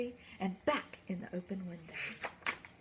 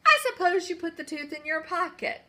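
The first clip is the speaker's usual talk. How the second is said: accusing queen; 7 semitones higher, 8 dB louder than usual. Acted.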